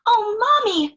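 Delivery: put-on high excited little girl voice. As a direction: squeal it like a delighted child. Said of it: "she said".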